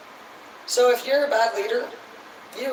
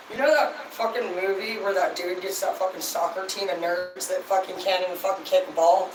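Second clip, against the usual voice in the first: ordinary talk, nothing marked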